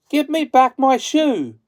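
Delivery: high, loud, indignant demand; angry girl. A narrator's reading. Dorothy demands her shoe from the witch, high and angry. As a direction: snap it back like a furious little girl. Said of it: "grew angry and said to the witch".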